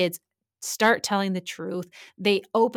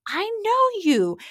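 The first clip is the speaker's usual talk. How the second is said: high, drawn-out surprised I know you; sudden shocked recognition, said slowly; startled recognition; 12 semitones higher, 4 dB louder than usual. Retold or imitated. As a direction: recognize her with a jolt. Recognizing suspect in jail cell.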